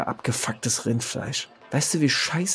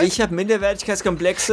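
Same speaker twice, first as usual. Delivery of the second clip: empört; 8 semitones higher than usual. Acted. Empörte Rückfrage im Streit.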